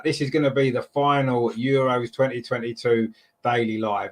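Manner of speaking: ordinary talk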